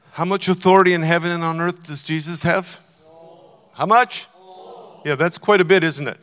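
rising pulpit fervour; full-force preaching. Real, not acted. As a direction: preach it out to the back row.

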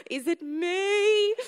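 high, slow, hopeful "Is it me?"; hope it is you; excited hope. Retold or imitated. Hoping the preacher's word is for her, excited. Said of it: "hopeful excited".

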